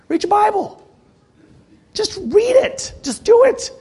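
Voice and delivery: high, rising urgent exhortation; urgent push. Acted. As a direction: drive it home like a coach. Urging congregation to act.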